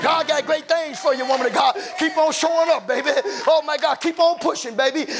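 loud, high, whooping sermon climax; preaching at full force. Acted. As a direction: rise into a shouted, sing-song preacher's whoop over the organ.

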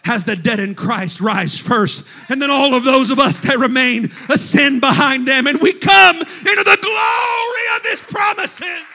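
rising pulpit proclamation; forceful preaching. Acted. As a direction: lift it triumphantly to a peak. Preaching, building.

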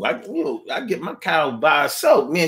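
ordinary talk, nothing marked

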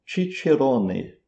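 "Cicerone" as chee-cheh-ROH-neh; Italian pronunciation. Shown as a demonstration. Teacher shows how Italians say the name, ch sounds in "Cicerone". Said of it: "Como que os italianos falam?".

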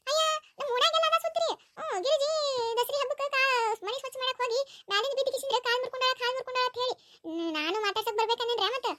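a nosy neighbour fussing over the injury; gossipy neighbour woman. Acted. Neighbour asks daughter-in-law about her leg, high chatty voice.

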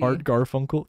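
ordinary talk

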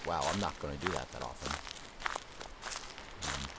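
very soft, low murmur; hushed, wary. Real, not acted. Player testing controls in a horror game, hushed and wary. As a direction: murmur it low, almost to yourself.

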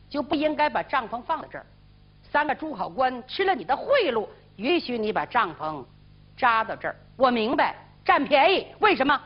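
ordinary delivery

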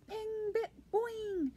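high, springy ping and boing; bouncing sound effects. Acted. Reader imitating the dropped pearl, springy sound effects. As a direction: bounce each noise out high.

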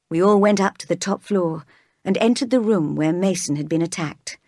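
ordinary delivery, nothing marked

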